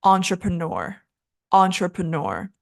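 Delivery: entrepreneur joined up as on-truh-pruh-NUR; schwa pronunciation. Shown as a demonstration. Teacher putting the syllables back together, entrepreneur with schwas. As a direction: blend entrepreneur smoothly with uh vowels. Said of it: "It's all schwa".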